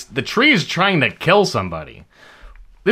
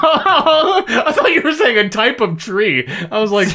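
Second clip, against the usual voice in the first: high and laughing; 6 semitones higher than usual. Real, not acted. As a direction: laugh through the words. Laughing at misunderstanding.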